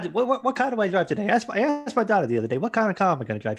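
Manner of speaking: stammering high talk-show comic; Jay Leno. Acted. Host character joking about his cars, high-pitched impression. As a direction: pitch it up, repeating words like a monologue comic. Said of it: "Jay Leno's here".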